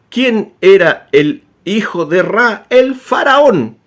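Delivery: slow question, then "El faraón" high and loud; dramatic reveal. Shown as a demonstration. Lecturer building up to the reveal for his viewers, slow and dramatic. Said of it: "rhetorical question and reveal".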